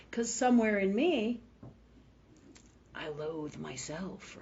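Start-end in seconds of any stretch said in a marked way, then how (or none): 2.9-4.4 very soft, slow admission; hushed self-admission. Retold or imitated. Admitting the same self-hatred lies in me, hushed. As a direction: say it slowly, almost to yourself.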